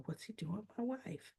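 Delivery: imitated suspicious thought. Husband privately wondering about his wife, hushed mock suspicion. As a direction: think it aloud under your breath.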